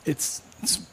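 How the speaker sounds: breathy scared whisper. Retold or imitated. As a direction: whisper it, barely voiced. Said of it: "I mumbled and I whispered".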